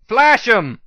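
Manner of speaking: high, loud battle command; shouted order. A narrator's reading. Hero shouts an order to the pegasus above. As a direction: bark it across the fight.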